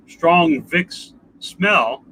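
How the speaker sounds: normal talking voice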